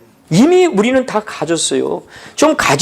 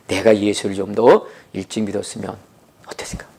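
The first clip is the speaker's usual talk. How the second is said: whispered regretful wondering, much softer; 12 semitones lower, 5 dB quieter than usual. Retold or imitated. Preacher voicing a complaining believer, whispering. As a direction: breathe it out as a hushed regret.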